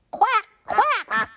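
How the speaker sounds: high quacking voice; duck quack. Acted. Reading to kids, a high duck quack. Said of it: "says the duck".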